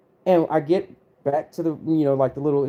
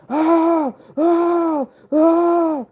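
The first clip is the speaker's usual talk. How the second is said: high, loud drawn-out "ah" screams; imitated screaming; 13 semitones higher, 5 dB louder, much slower than usual. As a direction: mimic a buddy's repeated screams from afar. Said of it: "I start hearing screaming".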